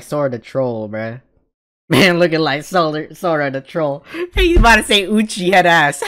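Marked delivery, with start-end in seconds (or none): 4.2-6.1 laughing out the words, high and loud. Real, not acted. Streamer cracking up at the anime character, laughing. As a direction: giggle through the line.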